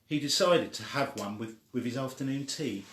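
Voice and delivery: usual speaking voice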